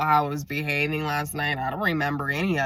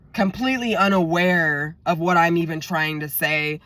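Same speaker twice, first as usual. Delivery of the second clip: ordinary delivery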